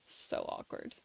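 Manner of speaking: breathy whispered so awkward. Pretend. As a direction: whisper it like a cringe. Laughing at an awkward moment.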